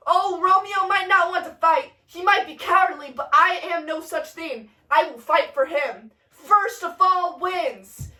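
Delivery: stagey fighting boast; Mercutio's bold challenge. Acted. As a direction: proclaim it to the whole square. Mercutio issues challenge in play.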